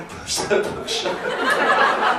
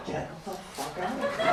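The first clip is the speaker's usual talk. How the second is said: high, hushed mock panic; retold freak-out; 7 semitones higher, 13 dB quieter than usual. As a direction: imitate a gym girl freaking out, high and soft. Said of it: "the girl started freaking out".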